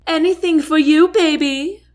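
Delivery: high, loud flirty call back. A narrator's reading. Lexi flirting back at the captain, high loud call. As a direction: tease him sweetly across the crowd. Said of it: "Lexi calls back at him".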